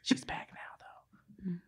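whispered aside; whispering. Real, not acted. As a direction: whisper it behind her back. Aside joke about co-host.

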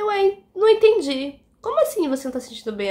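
acting genuinely confused to call her bluff; baffled retort. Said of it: "aí eu falei".

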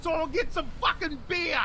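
worked-up Australian rant; exasperated plea. Pretend. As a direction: beg for a lift, fed up.

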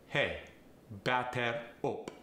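batter with a crisp clear T, never a flap; Farsi accent. Shown as a demonstration. Coach demonstrating a Farsi accent, batter with a sharp T. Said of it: "keep it a T".

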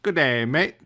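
put-on Australian greeting; Australian accent. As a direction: greet like a hammy Aussie. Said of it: "then became Australian".

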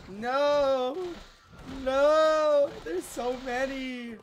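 high, drawn-out wail of dismay; dismayed cry. Real, not acted. Player overwhelmed by so many rats, dismayed. More rats keep coming.

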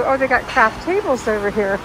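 ordinary talk, nothing marked

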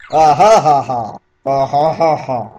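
slow fake ha-ha laugh; hammed-up laugh. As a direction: laugh it out in slow, even beats. Voices characters' laughter again.

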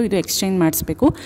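normal talking voice

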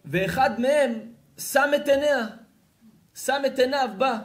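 high, loud declamation; Midrash recitation. A narrator's reading. Preacher recites the Midrash about the man's glance, declaiming. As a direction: proclaim the old text with weight.

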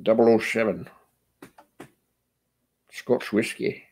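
slushy Scottish spy voice; Sean Connery. Acted. Streamer riffing as film spy, in slushy Scottish accent. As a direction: drop into a Scottish burr with "sh" for s. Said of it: "now I'm slipping into, uh, Sean Connery".

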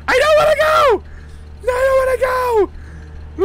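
high, loud frightened wail; terrified wail. Real, not acted. Gamer wails at being sent to the spiders. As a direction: wail that you won't go. Refusing to go down.

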